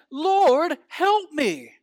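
high, loud cry for help; desperate plea. Acted. Hanging man crying up to the Lord, desperate plea. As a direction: beg loudly for rescue.

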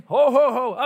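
loud jolly ho ho ho; big booming Santa chuckle; Santa laugh. Acted. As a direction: boom it out like Santa. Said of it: "Kinda like Santa".

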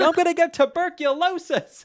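high, loud mock-panicked wail; imitated hysterical protest; hammed-up flipping out. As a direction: shriek it like a freaked-out sister. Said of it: "flipping out about how there's boat water".

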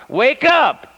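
loud high shouted wake-up call; shouting. Acted. Preacher to his drowsy congregation at services, shouting. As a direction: cry out at full pitch to rouse the congregation. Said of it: "stand back and shout".

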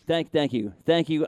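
normal talking voice

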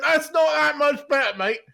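high, loud comic complaint; retold outburst, about to crack up; mock indignant protest. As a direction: object in exaggerated disbelief. Retells protest at second football badge.

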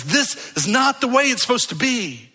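rising pastoral passion; impassioned outcry. Acted. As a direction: let the soul cry out against the wrong. Soul cries out at wrongness.

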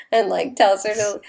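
normal talking voice

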